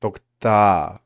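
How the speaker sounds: loud final stress, pitch kept level; stressed by volume. Shown as a demonstration. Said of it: "it's more, I guess, about the volume".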